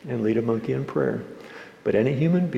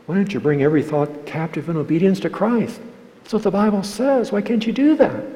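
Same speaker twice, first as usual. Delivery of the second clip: higher and louder, pressing and scolding; mock a counselor nagging scripture at them; pushy preachy lecture; 8 semitones higher, 5 dB louder than usual. Preacher mocking a counselor who nags a depressed person, pushy. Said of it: "Please don't say to a person who's depressed".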